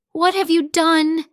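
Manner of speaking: cried. Acted. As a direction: gasp it out in shock. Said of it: "she cried".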